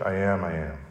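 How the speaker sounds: "I am, I am" spoken softly and low; quiet awed repetition; hushed reverent I am. Shown as a demonstration. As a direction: drop the voice for "I am, I am". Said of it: "the very same thing that Jesus says".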